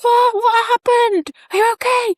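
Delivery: husband startled by the scream, high alarmed questions. Retold or imitated. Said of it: "And he was like".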